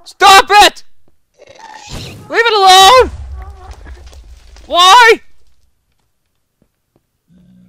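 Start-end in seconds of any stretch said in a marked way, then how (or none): 0.0-5.2 high, loud desperate cries; panicked yelling. Real, not acted. Gamer yelling at a mob taking his stuff, panicked. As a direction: yell at the mob to stop.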